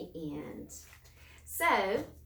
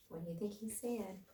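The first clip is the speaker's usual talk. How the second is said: usual speaking voice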